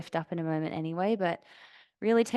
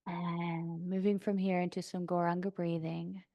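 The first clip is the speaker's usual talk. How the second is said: calm yoga-teacher meditation voice, long pauses; soft, settled breathing-practice guidance; guided meditation; 4 dB quieter than usual. Real, not acted. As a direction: guide them gently into stillness, unhurried. Leads seated breathing meditation.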